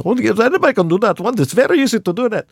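high-pitched mock Indian accent. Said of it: "someone who's speaking with an Indian accent".

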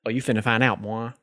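ordinary delivery

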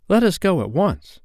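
put-on high, urgent boy voice; boy raring to set off; excited cry. A narrator's reading. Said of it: "cried John".

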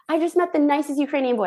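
quick, high, delighted rush; to her partner backstage, thrilled and quick; giddy gushing. Retold or imitated. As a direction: blurt it out, thrilled. Telling partner, excited.

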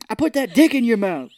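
loud, high hammed-up yell; mock tirade. Host re-enacts the misheard film rant, mock outraged yell. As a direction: belt it out as an over-the-top impression.

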